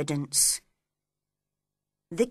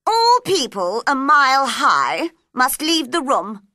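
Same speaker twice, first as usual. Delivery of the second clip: loud, raised royal proclamation; commanding queen; 4 semitones higher, 8 dB louder than usual. Acted. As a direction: proclaim the rule to the whole court.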